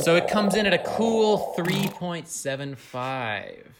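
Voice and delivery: slow, drawn-out score reveal; announcer voice. Pretend. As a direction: read the score like a game show host.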